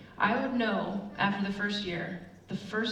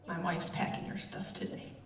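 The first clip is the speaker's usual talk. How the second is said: hushed confession; 2 semitones lower, 8 dB quieter than usual. Retold or imitated. Friend confides marriage trouble.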